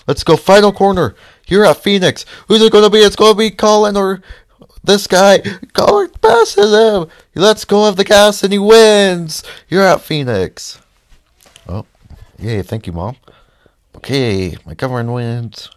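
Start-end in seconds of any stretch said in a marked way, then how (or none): 0.1-10.8 much higher, louder play-by-play call; race announcer. Acted. Gamer calling his own race finish like a hyped announcer.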